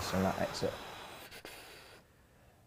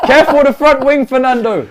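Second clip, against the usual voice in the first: laughing; 13 semitones higher, 7 dB louder than usual. Real, not acted. Laughing reaction to crash.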